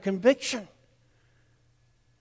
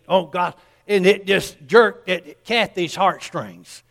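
ordinary talk, nothing marked

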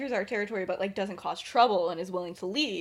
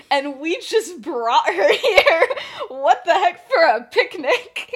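laughing through it; 6 semitones higher, 9 dB louder, slower than usual. Retold or imitated. James panicking over bringing Lily.

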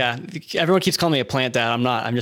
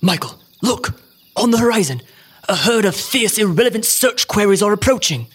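higher, louder alarmed call; excited narrator; 6 semitones higher, 5 dB louder than usual. Pretend. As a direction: point out the danger urgently.

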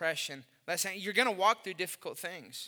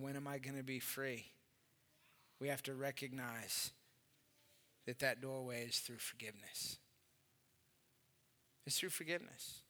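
soft reflective preaching; 7 semitones lower, 11 dB quieter than usual. Acted. Pastor quietly urging his church toward forgiveness, soft and intimate. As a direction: draw the church in gently with quiet words.

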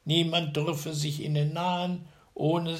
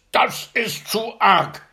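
loud, much higher, slow roar in a giant voice; furious giant shout; 9 semitones higher, 11 dB louder, slower than usual. A narrator's reading. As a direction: explode at the last stone. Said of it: "schrie er".

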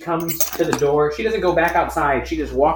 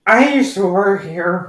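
slow, higher, louder tipsy drawl; drunk woman impression; 5 semitones higher, 6 dB louder, slower than usual. Drunk customer telling the clerk she used to work here, slow and tipsy. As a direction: play the drunk customer slurring her claim. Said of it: "she goes".

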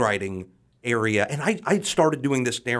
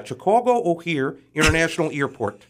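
higher-pitched Chicago mayor impression; warm-up phrase in a put-on Chicago voice; Richard Daley; 5 semitones higher than usual. Shown as a demonstration. Finding a phrase to get into the voice, Chicago mayor impression. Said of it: "impression of former Chicago Mayor Richard Daley".

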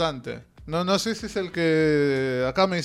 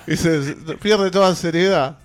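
laughing; 6 dB louder than usual. Real, not acted. Laughing at a joke on air.

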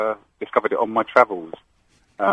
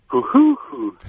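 much higher, quick three-note pigeon hoot; Hungarian pigeon coo; much slower than usual. Shown as a demonstration. Host asks for the Hungarian pigeon, caller hoots much higher. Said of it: "now do the Hungarian one".

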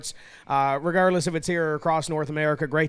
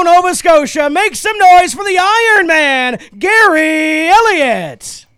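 booming announcer, names stretched and shouted; driver introductions; 7 semitones higher, 10 dB louder than usual. Acted. Track announcer introduces the starting field.

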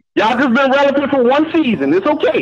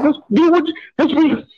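ordinary talk

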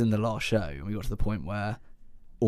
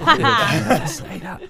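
higher, louder laughing mock outrage; mock indignant, laughing; 11 semitones higher, 10 dB louder than usual. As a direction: exaggerate the offended protest. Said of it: "and you're just like".

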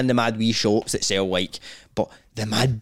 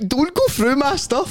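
high, slow scolding woman's voice; mock high shrill-free scold; landlady snapping; 11 semitones higher, slower than usual. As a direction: tell him off like an irritated landlady. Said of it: "she was like".

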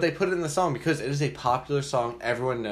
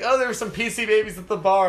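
mock character voice; 10 semitones higher, 7 dB louder than usual.

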